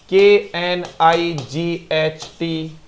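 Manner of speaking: slow spelled letters K N I G H T. Shown as a demonstration. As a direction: read each letter slowly and apart. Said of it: "spelling out knight". (धीरे-धीरे अक्षर बोलना)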